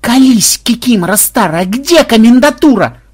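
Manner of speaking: high, loud wail of frustration; exasperated howl. A narrator's reading. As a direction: howl the question, stretching the last words. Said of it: "взвыл Ксенобайт".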